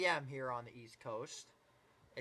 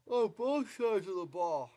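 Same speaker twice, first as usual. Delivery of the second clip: yawning; 6 semitones higher than usual. Real, not acted. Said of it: "Excuse me for yawning".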